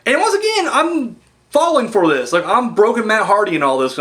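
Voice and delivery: usual speaking voice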